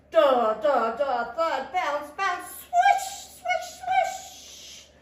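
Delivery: loud thuds rising into high swishes; crashing noises. A narrator's reading. Beaver's falling tree waking Tortoise, crashing and swishing. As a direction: pound the thuds, then hiss high swishes.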